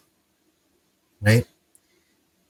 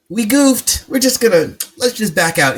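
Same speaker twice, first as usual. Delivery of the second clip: lightly voiced backtracking leaders, pitched higher; mock admission; 7 semitones higher than usual. Imagined leaders admitting a mistake.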